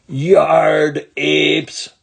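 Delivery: loud yell leaning on yard; angry yell again. Acted. Ramona yells at the boys, teary and furious. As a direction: hurl it, near tears of rage. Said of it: "she yelled again".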